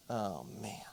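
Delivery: very soft, low, sinking realization; deflated. Retold or imitated. Dad realizing he blamed the wrong boy, quietly deflated. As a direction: let it drop away under your breath.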